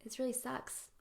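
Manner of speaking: soft, forlorn and small; hushed, mildly miserable complaint; lonely little bear. Acted. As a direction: speak quietly from the bottom of a hole. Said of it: "the bear looks up and says".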